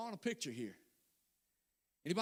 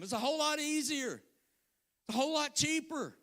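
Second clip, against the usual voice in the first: raised, higher sermon delivery; preaching; 4 semitones higher than usual. Acted. Pastor preaching to the congregation, fervent.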